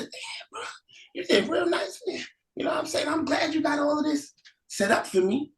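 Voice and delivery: hushed, impressed aside to brother; awed hush. Acted. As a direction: marvel under your breath.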